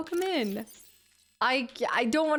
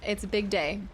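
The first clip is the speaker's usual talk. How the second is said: usual speaking voice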